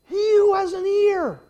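high, slow, drawn-out shout; impassioned pulpit shout; full-force preaching. Acted. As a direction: cry each phrase out with great force. Preacher cries out the verse.

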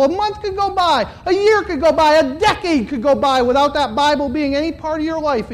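loud, high, driving sermon climax; preaching at full force. Real, not acted. Preacher rebuking a content congregation, loud and high. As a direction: hammer the point home to the congregation.

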